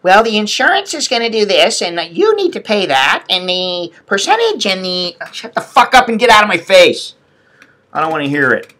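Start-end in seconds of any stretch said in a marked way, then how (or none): 0.0-5.2 higher-pitched imitation of a harping lady; mock harping. Billing lady going on about insurance, mock harping. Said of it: "fucking harping on me about". 5.3-7.1 higher-pitched angry cut-in; a touch of a retold yell; re-enacted yelling. Patient cutting off billing lady, mildly re-enacted yell. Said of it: "when I was yelling at her".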